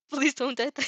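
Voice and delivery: usual speaking voice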